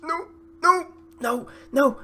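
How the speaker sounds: very high, repeated squeals; panicked. Real, not acted. Gamer fearing a goal against him, panicked. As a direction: squeal each one in alarm.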